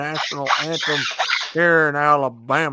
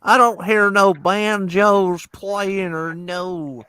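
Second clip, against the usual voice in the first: louder and higher, slow, a put-on hillbilly drawl; mock Southern gripe; 5 semitones higher, 6 dB louder, slower than usual. Man mocking Alabama with a loud slow Southern drawl.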